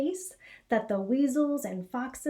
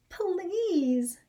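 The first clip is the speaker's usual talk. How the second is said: mother crying out; 8 semitones higher than usual. Acted. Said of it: "cried his mother".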